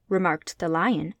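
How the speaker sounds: usual speaking voice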